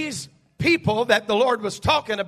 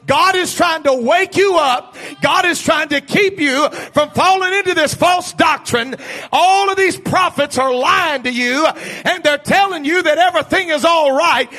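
loud, higher urgent prophet shouting to Israel; prophet's wake-up call; 5 semitones higher, 5 dB louder than usual. Acted. The prophet shouting at Israel, loud urgent call. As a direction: rouse sleepers like a prophet crying out. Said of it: "to prophesy to the children of Israel and say".

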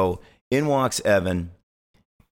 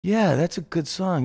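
impersonating Evan; 9 semitones higher, slower than usual. Retold or imitated. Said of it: "he's like".

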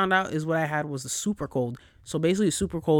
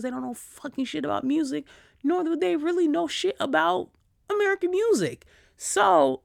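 high-pitched, incredulous complaint; squeaky, indignant dismissal; exasperated disbelief; 12 semitones higher than usual. Real, not acted. Exasperated rant about someone's ignorance.

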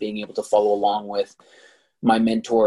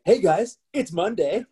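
mock host voice; 7 semitones higher, slower than usual. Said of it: "you put your game face on and you start talking".

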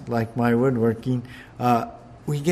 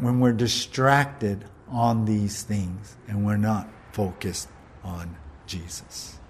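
ordinary talk

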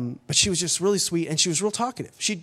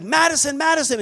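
high, urgent call of a name; retold calling out; 7 semitones higher, 3 dB louder than usual. Father running to his found daughter, calling out. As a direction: call to her across the sand, pitched high.

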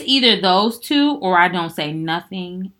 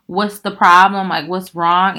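pressing question; 9 dB louder, slower than usual. Retold or imitated. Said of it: "if I'm asking a guy".

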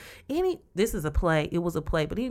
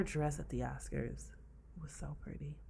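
hushed, whispered admiration; very soft, breath-only swoon; admiring whisper; 3 semitones lower, 13 dB quieter than usual. Real, not acted. Admiring the Oscars dress.

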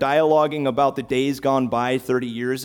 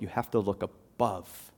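ordinary delivery

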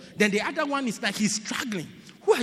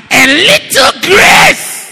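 slow rising shout, much higher and louder; shouted, drawn out; 22 dB louder, much slower than usual. Shown as a demonstration. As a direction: stretch the word grace out in a shout. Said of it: "That is what we all need".

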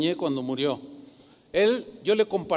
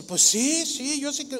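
higher-pitched, a hint of a humble woman; lightly voiced humble woman; 7 semitones higher, slower than usual. Humble woman answers the pastor, lightly imitated assent. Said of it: "una señora humilde".

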